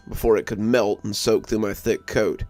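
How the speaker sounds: usual speaking voice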